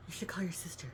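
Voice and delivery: a hushed whisper, no voice; whispered reminder. Retold or imitated. Caregiver nudging her relative to call her sister, whispered.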